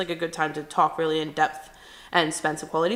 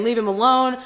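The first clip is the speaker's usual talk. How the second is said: louder, urgent plea; begging him to stop, raised voice; pleading shout; 4 semitones higher, 6 dB louder than usual. Retold or imitated. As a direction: sister pulling her brother away, pleading loudly. Pulling brother off Brett.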